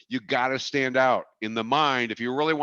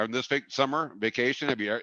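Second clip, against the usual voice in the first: ordinary talk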